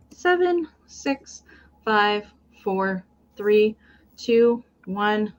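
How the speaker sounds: steady, paced countdown calling out each number; live auction host counting down. Acted. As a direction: count the bids down one by one.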